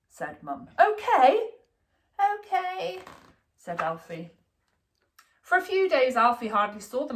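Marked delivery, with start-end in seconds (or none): 0.8-3.0 put-on little boy giving in. A narrator's reading. Child giving in.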